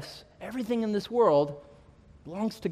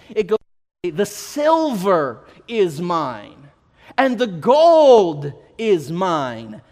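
loud, slow and rising, much louder than the story; full-force preaching; 3 semitones higher, 6 dB louder, slower than usual. Acted. Pastor proclaiming God's ownership to the church, full-force preaching. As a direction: preach it out to the whole congregation.

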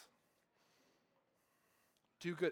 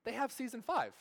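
high, rushed realization; excited relief; 7 semitones higher than usual. Retold or imitated. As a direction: gasp out the relief like a fan. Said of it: "I'm like".

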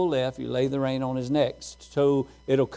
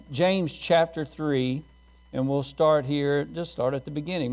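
ordinary delivery

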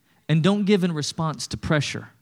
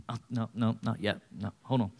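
much softer and lower, hesitant take-back; reluctant giver; 5 semitones lower, 8 dB quieter than usual. Pretend. Grudging giver taking the offering back, hesitant and soft. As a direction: pull the gift back with a low, hesitant mumble.

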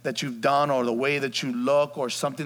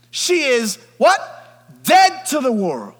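slow, loud prompt; call and response; 11 semitones higher, 11 dB louder, slower than usual. Acted. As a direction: draw the answer out of the room.

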